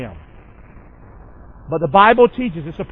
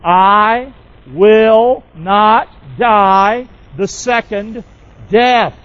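very slow and louder, every word spaced apart; slow declaration; 2 semitones higher, 6 dB louder, much slower than usual. Shown as a demonstration. Pastor leads the church, slow and emphatic word by word. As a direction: draw each word out so the church can echo it. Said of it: "Would you say that with me?".

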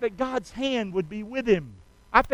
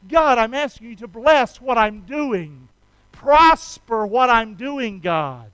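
loud, earnest praying; voicing a prayer to God; fervent prayer; 7 dB louder, slower than usual. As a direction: pray it out with passion. Voiced prayer.

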